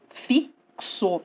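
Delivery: "fixo" em duas partes, fik-so, destacando o KS; X com som de KS. Shown as a demonstration. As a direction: demonstre "fixo" com pausa e X soando KS. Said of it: "tem o som de um K S". ("fixo" pausado, com KS marcado)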